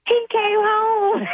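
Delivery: very high, slow, joyful cry; joyful scream. Retold or imitated. Grandma finds her lizard home, very high joyful cry. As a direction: shriek it out with delight. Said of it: "you could hear me screaming".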